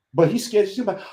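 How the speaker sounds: usual speaking voice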